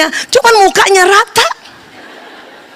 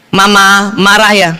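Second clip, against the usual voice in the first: slow careful question; 8 semitones lower, slower than usual. Acted. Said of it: "tolong tanya tiga kali".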